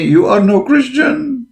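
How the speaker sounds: high, slow, sneering mimicry of a critic; mock scolding. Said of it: "they say".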